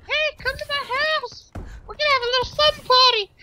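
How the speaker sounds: put-on very high girly invitation; put-on girl voice. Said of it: "be the voice of the chick".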